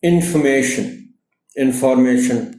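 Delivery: Information with the beat on मे; information, lean on the मे; stress on -ma-. Shown as a demonstration. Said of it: "stress जो है, वो एम पर है".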